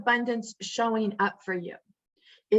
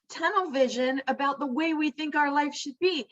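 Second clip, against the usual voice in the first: usual speaking voice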